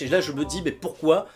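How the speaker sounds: ordinary delivery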